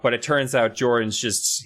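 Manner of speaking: usual speaking voice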